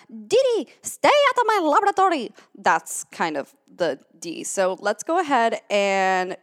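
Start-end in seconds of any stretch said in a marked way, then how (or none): 0.1-2.3 high, loud cartoon boy-genius impression; Dexter. Cartoon character ordering Dee Dee out of his lab, exasperated. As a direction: scold your sister in an exaggerated cartoon voice. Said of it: "like Dee Dee from Dexter's Lab".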